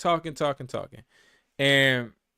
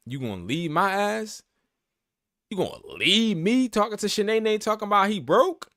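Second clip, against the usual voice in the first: higher and louder, outraged mock challenge; indignant; 7 semitones higher, 5 dB louder than usual. Man imagining his partner leaving him, outraged and loud. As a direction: throw the question back, offended. Mocking a partner who leaves.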